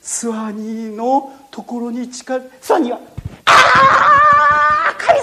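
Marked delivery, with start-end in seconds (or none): 3.5-5.2 high, loud cry to God; terrified scream. Acted. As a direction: shriek for help. Boy screams as crocodile approaches. (ワニに迫られて神様と叫ぶ)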